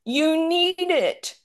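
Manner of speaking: slow, high, drawn-out imitation of a weak speaker; mimicked husband struggling to talk; imitating labored speech. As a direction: stretch each word high and effortful. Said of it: "he could hardly talk".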